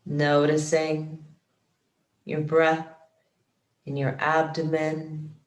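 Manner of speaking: measured, calm yoga nidra guidance, phrase by phrase; guided meditation. Acted. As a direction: guide them into relaxation, unhurried and steady. Leads guided relaxation practice.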